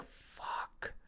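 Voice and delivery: breathy stunned whisper; whispered curse. Pretend. Alabama fan's stunned reaction, whispered. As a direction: mouth the curse in shock. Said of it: "You're like".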